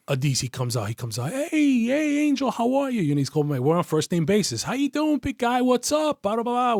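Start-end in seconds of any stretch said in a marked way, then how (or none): 1.3-3.0 high-pitched, gushing hello; high mock-friendly impersonation; effusive greeting. Acts out suspect's friendly greeting. 4.6-6.7 high, chummy patter; high mock-friendly small talk; effusive greeting. Suspect's chummy small talk acted.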